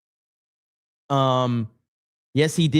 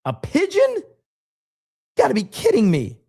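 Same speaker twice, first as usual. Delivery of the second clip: high-pitched, stunned and incredulous; genuinely can't believe it; shocked disbelief; 10 semitones higher than usual. Real, not acted. Reacting to pigeon-sized spider.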